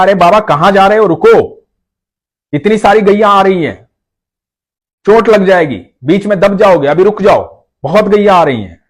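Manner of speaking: put-on high, loud boy calling after the old man; young boy calling out a warning; child calling out. A narrator's reading. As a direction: shout a warning like a young cowherd. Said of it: "पीछे से एक बालक ने आवाज दी।".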